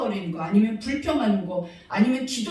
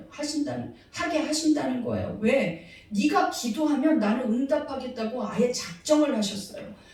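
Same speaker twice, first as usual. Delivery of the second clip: ordinary talk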